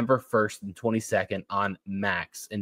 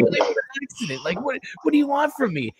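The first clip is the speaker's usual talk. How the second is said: high, hammed-up defensive plea; mock protesting; 13 semitones higher than usual. Golfer defending himself to the veterans, high mock protest. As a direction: imitate a flustered golfer pleading innocence.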